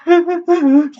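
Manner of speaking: high, hammed-up boo-hoo sobs; acted sobbing. A narrator's reading. Daughter weeping in the wine cellar, sobbing. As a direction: sob theatrically, way up high. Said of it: "sobbed Amity".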